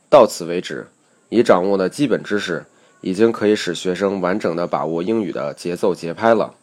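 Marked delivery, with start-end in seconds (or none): none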